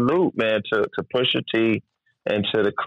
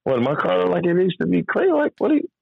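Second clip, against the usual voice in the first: mock disbelief; 7 semitones higher than usual. Incredulous retelling.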